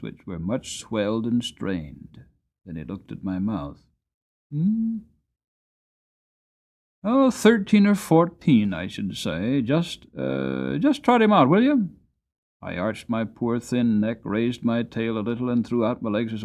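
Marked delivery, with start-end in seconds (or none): 4.5-11.9 put-on louder, brisk old man's voice; a farmer judging a horse's age; appraising old farmer. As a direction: farmer tells the dealer to trot the horse out, lightly voiced.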